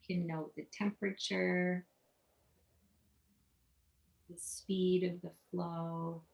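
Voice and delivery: soft, unhurried meditation voice with long pauses; guided meditation. Real, not acted. Meditation guide leading listeners to notice their breath, soft guided meditation. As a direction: quietly guide them through each breath, letting silences sit.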